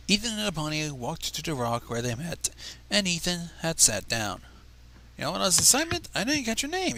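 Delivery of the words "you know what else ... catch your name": curious, rising high on the question; puzzled, probing for her name; quizzical. A narrator's reading. Ethan asks the shy pony her name, quizzically. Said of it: "Ethan said quizzically".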